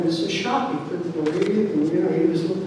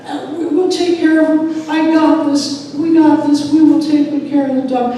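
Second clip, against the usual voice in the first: high, loud, insistent assurance; eager child promising; 9 semitones higher, 9 dB louder than usual. Retold or imitated. Children promising mom to care for the duck, eager. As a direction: imitate a kid swearing to be responsible.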